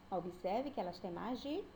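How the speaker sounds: normal talking voice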